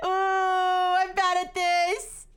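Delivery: slow giggly high laugh. Real, not acted. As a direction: laugh it out, embarrassed. Flustered laugh at own failure.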